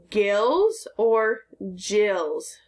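"gills" with a hard g, then "jills" with a j, very slow; contrasting g sounds. Shown as a demonstration. Teacher asking the class which g sound fish have, very slow. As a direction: drag out both choices slowly. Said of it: "Is it".

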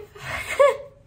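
airy high laugh on the edge of crying; real choked-up laugh; breathless teary laugh. Real, not acted. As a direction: laugh as tears come. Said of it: "I'm gonna cry".

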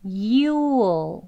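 YOU'LL as long "yoo-ol"; drawn-out full "You'll"; first, full contraction. Shown as a demonstration. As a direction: keep the whole oo in "You'll", then add dark l. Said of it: "U에다가 그냥 어만 붙은 발음".

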